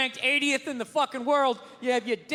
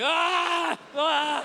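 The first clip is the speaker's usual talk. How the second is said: shouting in shower; 4 semitones higher than usual. Retold or imitated. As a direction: yell the cringe out twice. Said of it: "just being like".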